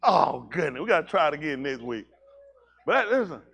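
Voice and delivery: ordinary talk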